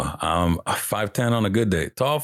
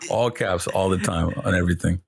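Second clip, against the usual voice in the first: ordinary talk, nothing marked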